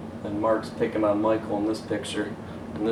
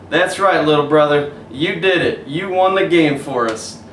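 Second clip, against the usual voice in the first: louder cheerful praise; celebrating brother; 5 semitones higher, 7 dB louder than usual. Acted.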